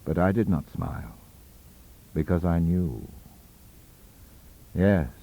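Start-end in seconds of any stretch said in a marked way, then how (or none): none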